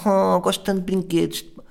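put-on high childish gushing voice; cutesy woman. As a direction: gush over the toys like a little girl. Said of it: "E assim a mexer na pá". (voz infantil aguda, a imitar a mulher na praia)

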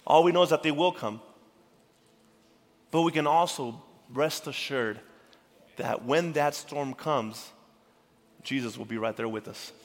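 usual speaking voice

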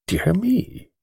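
mild annoyance. Acted. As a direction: fussily put out by unwelcome news. Treves vexed by a letter.

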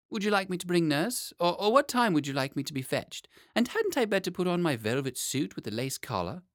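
put-on high, chattering child; a boy fussing over what to wear; eager little boy.

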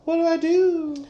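high panicked little cry; worried Celia. Retold or imitated. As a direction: fret like a worried girlfriend. Celia worrying, voiced high.

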